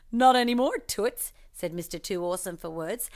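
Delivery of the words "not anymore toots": higher, louder swaggering brush-off; cocky. Acted. Hero carrying Alba off, cocky swagger. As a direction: toss it off like a smooth hero.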